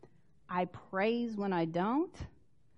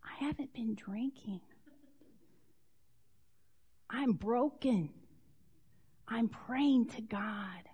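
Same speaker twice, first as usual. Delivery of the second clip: soft broken plea; 4 dB quieter than usual. Acted. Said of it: "She's like".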